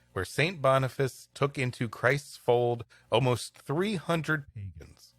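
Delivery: normal talking voice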